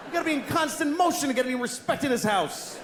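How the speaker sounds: a fish jolted from its nap, yelling in outrage; outraged fish. Retold or imitated. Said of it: "it looks like he goes".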